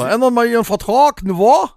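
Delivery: loud, high mock Saxon sales pitch; Saxon accent. Hotline agent from Saxony changing a customer's contract, hammed-up Saxon accent. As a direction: push it in exaggerated Saxon. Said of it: "Und dann hast du jemand aus Sachsen dran".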